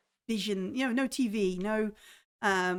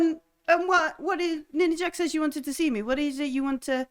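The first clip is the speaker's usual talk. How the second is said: put-on higher, pointed old woman; aunt asking the captain what she wants; questioning old aunt; 6 semitones higher, 4 dB louder than usual. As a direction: press them for their reason. Aunt asks purpose.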